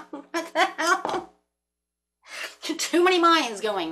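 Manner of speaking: high, slow laughing disbelief; giggling through the words. Real, not acted. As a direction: laugh at your own mess.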